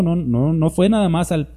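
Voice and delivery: normal talking voice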